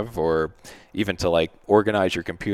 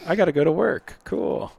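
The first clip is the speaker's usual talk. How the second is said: high, bright brush-off; mock cheery escape; 10 semitones higher than usual. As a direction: chirp it and bail. Host escaping the houseguest.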